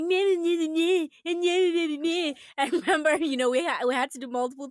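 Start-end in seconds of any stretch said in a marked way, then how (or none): 0.0-2.3 lightly retold mocking bleats; half-hearted imitation of childish mocking; mocking meh. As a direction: tease like a kid sister. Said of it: "she's mimicking him ... And she's like".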